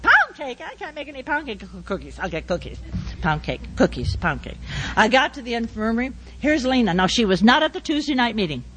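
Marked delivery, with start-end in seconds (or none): 0.0-0.6 high, startled double-take; surprised. Real, not acted. Preacher startled by her own menu, high surprised double-take. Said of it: "surprised at own list". 0.6-4.6 low, soft fretting mutter; muttering to herself. Real, not acted. Preacher fretting over baking, soft low mutter. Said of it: "muttering to herself".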